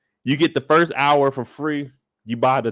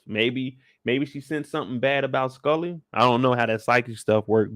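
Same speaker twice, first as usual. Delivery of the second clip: ordinary talk, nothing marked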